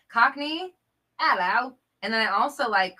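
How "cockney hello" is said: loud, higher Cockney hello; hammed-up Cockney greeting; Cockney accent. Host tries a Cockney accent for chat, loud and high. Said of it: "Cockney, is that the one?".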